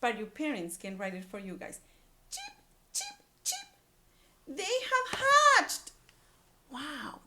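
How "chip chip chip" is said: very high chick peeping chip chip; chick peeps. A narrator's reading. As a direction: peep like a tiny chick.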